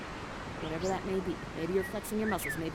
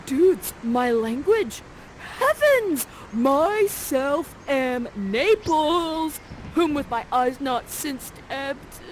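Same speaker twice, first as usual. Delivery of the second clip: louder, slow, hammed-up prince; milk every word like a melodramatic hero; dramatic Ferdinand; 4 semitones higher, 4 dB louder, much slower than usual. Ferdinand hearing his own language, loud and slow.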